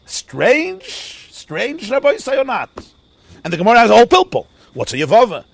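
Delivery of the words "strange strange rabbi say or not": high questioning then drop; mock bafflement. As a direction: play the puzzled student. Quoting a puzzled listener.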